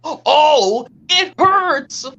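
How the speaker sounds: high-pitched wail of pain; hurt cartoon character. Character with a hurt foot, acted pained cry. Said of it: "why don't we do a quick impression".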